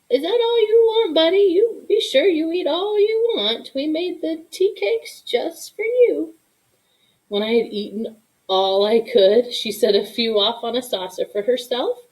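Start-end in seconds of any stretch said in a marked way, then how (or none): 0.1-6.3 fond grandma. Acted. Grandma urging boy to eat.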